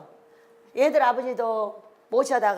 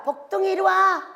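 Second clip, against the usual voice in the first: cheerful greeting; 7 semitones higher, 3 dB louder than usual. Retold or imitated. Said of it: "우리 지금 인사가".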